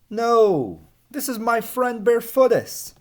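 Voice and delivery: calm the villagers down happily; cheerful Hans. Acted. Hans reassuring the scared villagers, cheerful. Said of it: "replied Hans".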